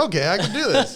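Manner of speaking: a hammed-up happy outburst; relieved excitement. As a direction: gush like a weight just lifted. Pumping himself up.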